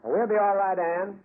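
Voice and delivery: calling down. Acted. Detective calls down from the tomb roof to his assistant, loud reassuring call. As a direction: shout down cheerfully to reassure her.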